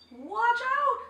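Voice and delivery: high, loud squawk; squawking parrot. A narrator's reading. Parrot warning the chimpanzee, high loud squawk. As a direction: screech the warning. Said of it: "squawked the parrot".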